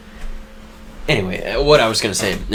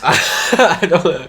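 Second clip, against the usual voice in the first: slow, louder-pitched words through laughter; laughing; 5 semitones higher, 3 dB louder, much slower than usual. Real, not acted. Co-host amused by the failed Russian accent, laughing.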